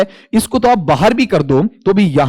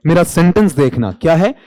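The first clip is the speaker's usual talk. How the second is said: ordinary talk, nothing marked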